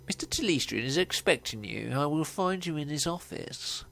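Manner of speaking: put-on fussy elf butler accent, lilting up at the end; fussy Victorian butler. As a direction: play a stiffly formal Jeeves. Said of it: "the fussy elf with an ever Victorian, he is a butler".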